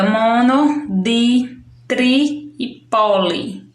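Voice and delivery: "mono, di, tri e poli" slowly, one at a time; deliberate list "mono... di... tri... e poli"; highlighted prefixes. Shown as a demonstration. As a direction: set apart each of "mono, di, tri e poli" with pauses. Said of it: "observe a parte colorida".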